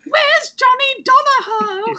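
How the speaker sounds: put-on high, stretched child's whine; annoyed daughter. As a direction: imitate a child demanding a parent by full name. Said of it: "like, vaguely annoyed at us".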